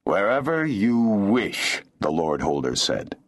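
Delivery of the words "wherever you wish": slow, higher, indifferent lordly reply; slow, haughty dismissal; cold indifference. A narrator's reading. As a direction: draw it out with cold unconcern. Lord indifferent to woman's plea.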